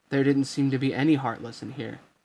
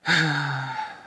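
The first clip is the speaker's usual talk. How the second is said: long weary exhale; a slow tired sigh through the nose; heavy sigh; 2 semitones higher than usual. A narrator's reading. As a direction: sigh it out heavily, fed up. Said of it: "Zexion sighed heavily".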